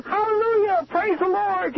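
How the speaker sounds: high, joyful shout of praise; like a big kid yelling hallelujah; excited praise. Retold or imitated. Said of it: "But he was so excited".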